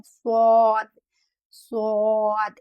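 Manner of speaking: स्वाद loud and drawn out, thick and heavy; heavy swad. Shown as a demonstration. Teacher models the heavy letter for practice, slow and heavy. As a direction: stretch स्वाद slowly with a heavy sound. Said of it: "इस तरह से हमें स्वाद हरूफ को पढ़ना है".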